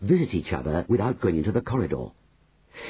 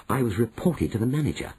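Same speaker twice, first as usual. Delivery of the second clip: ordinary delivery, nothing marked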